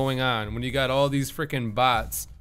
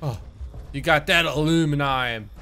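usual speaking voice

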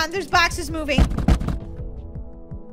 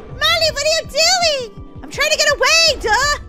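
high, loud, frantic exchange; frantic high-pitched protest; panicked bickering; 6 semitones higher, 5 dB louder than usual. Acted. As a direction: yell while scrambling to get away. Acted panicked bickering while fleeing.

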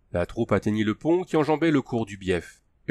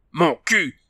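higher-pitched growled retort; 7 semitones higher, 4 dB louder than usual. A narrator's reading. Hans objects to the Tugar's demand, growling. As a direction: growl back in defiance. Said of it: "grogna Hans".

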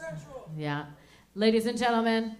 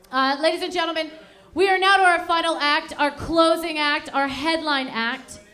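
hype announcer; 5 semitones higher, 4 dB louder than usual. Real, not acted. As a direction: hype up the headliner. Building hype for headliner.

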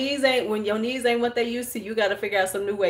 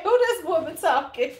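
imitating the child; 8 semitones higher, 3 dB louder than usual. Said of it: "He like".

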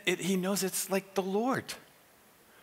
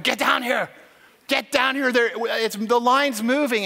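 loud, high, urgent shout down the phone; urgent call; 4 semitones higher, 5 dB louder than usual. Acted. Friend phoning to say the line's moving, urgent call. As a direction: call him down here, raised and pressing.

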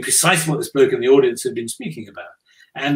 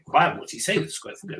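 hushed, puzzled aside; baffled murmur; 4 semitones higher, 7 dB quieter than usual. Retold or imitated. Audience puzzling over the guest's answer, hushed bafflement. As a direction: drop right down as if murmuring to a neighbour.

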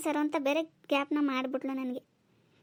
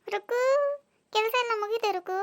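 calling out; 6 semitones higher than usual. Acted. Neighbour greeting her friend about finished chores, calling out. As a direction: call to a neighbour in a raised pitch. Arriving neighbour calls out to Ruku.

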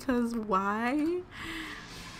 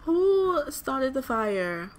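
drawn-out, incredulous question; slow demand; slower than usual. Real, not acted. Gamer asking again who caused the fire, slow demand. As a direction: stretch out WHO and slow right down.

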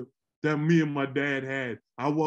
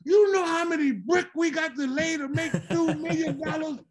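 much higher, incredulous father voice; exasperated dad; 10 semitones higher than usual. Retold or imitated. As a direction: scold like a hard-working brick mason.

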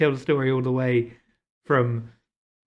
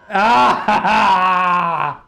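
frustrated wail; 5 semitones higher, 9 dB louder, much slower than usual. Real, not acted. Frustrated gamer falls again.

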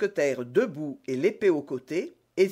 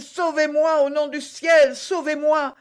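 high, loud, desperate pleading; panicked cry; 11 semitones higher, 7 dB louder than usual. A narrator's reading. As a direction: beg to be saved, wailing on a high held pitch. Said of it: "s'écria-t-il". (supplication affolée, voix aiguë)

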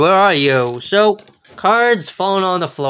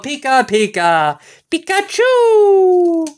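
Pikachu cry; 8 semitones higher, slower than usual. Pretend.

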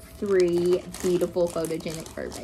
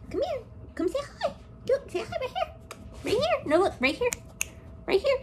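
much higher sing-song coaxing voice; coaxing the dog; faster than usual. Real, not acted. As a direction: call the dog over, pitched up high. Coaxing her dog to the camera.

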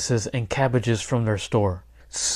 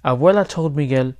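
plain reading voice

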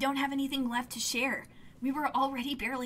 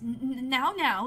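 nervous stuttered start; stammering. Acted. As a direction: stumble over the words. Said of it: "they stammer".

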